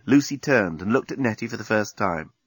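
ordinary delivery

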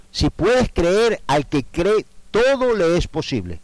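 high ringing proclamation, slowly drawn out; 1 emphatic quote. Acted. Preacher voicing Jesus to the congregation, loud and high. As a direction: proclaim the promise to the crowd.

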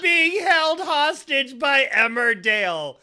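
mock hostage plea.

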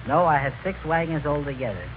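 ordinary talk

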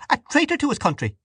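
very high, fast denunciation; angry accusation. A narrator's reading. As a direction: fling the charge across the table. Dante's furious accusation in quarrel.

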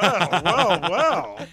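loud drawn-out teasing; slow mock-impressed "well".

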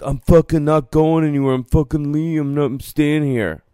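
high put-on voice of a stubborn jerk; mock the guy who won't leave, high and whiny; angry other driver. Parked driver refusing to give up his spot, high whiny mock voice. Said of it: "And he's like".

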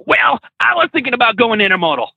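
high-pitched mock imitation of a guy; mimicked eager guy. Mimicking a would-be colleague.

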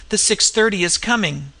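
plain reading voice

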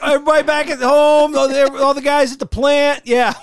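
high, loud fan shout; mock fan shout-out. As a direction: shout hello to the plant guys. Continuing fan's shout-outs.

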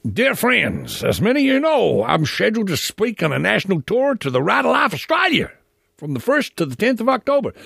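mock letter-reading in a high caricature accent; Troy Newman, generic accent. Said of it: "I generally talk in that sort of accent".

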